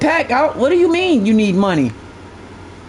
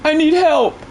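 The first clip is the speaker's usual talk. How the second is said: higher, whiny mock plea; higher mock "I need help"; mock whine; 6 semitones higher, slower than usual. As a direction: imitate him begging. Mocks viewer's plea.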